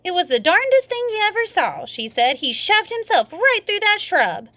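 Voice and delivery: innocent-sounding fib, pitched high; high, sly girl voice. A narrator's reading. May explaining to the daddy, high and innocent. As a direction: play it innocent to the grown-up.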